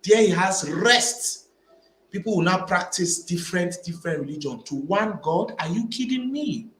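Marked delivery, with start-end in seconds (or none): none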